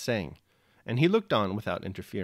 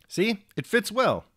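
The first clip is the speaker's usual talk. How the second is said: slow, much higher, beaming peasant tailor; pleased praise; 10 semitones higher, 5 dB louder, slower than usual. A narrator's reading. Platon to the Frenchman about the shirt, pleased. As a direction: coax it out, proud of the fit.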